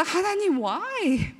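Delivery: lifted, drawn-out why to God; exasperated plea. Retold or imitated. Teen walking past strange men, exasperated plea to God. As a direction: sigh it out like a fed-up kid. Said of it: "그럴 때마다".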